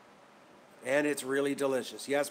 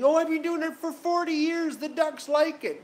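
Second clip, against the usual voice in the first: put-on much higher, louder sing-song old lady voice; mocking old lady; 8 dB louder than usual. Vlogger mocking old lady force-feeding ducks, high mock voice. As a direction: ham up her cheerful innocence. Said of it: "And this old lady".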